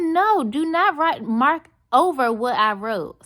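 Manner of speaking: slow, high, firm "do not write"; stern command. Retold or imitated. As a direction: lay down the law. Ordering waitress not to write.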